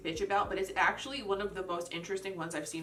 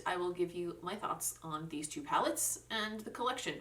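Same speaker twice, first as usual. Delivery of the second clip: ordinary talk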